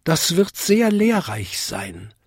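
high little-bell voice; lightly bright tiny bell. A narrator's reading. Vinzi answers Bung. (hohe kleine Glocke)

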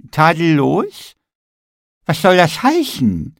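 high, slow offended gingerbread man; indignant question. A narrator's reading. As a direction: drag out Tadellos in disbelief. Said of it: "fragte der Nikolaus". (empörte, hohe Frage des Nikolaus)

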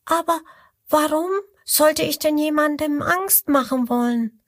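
high, innocent little voice; genuinely wondering, small and sweet; little crocodile asking. Acted. Little crocodile asks why scare anyone, high innocent voice. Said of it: "fragt das kleine Krokodil".

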